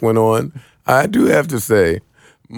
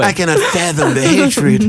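higher, teasing parrot of Marty's line; mock Marty; 7 semitones higher than usual. Friend mocking Marty's rant with a teasing high parrot. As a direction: echo her words back in a mocking tone. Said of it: "she said fathom".

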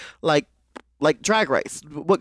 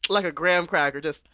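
ordinary talk, nothing marked

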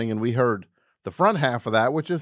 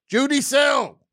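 high, loud yelled name; sudden yell; 5 dB louder than usual. Real, not acted. Host naming folk singers to sound cool, sudden yell. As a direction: blurt the name out at full yell. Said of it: "I don't know, I yelled it".

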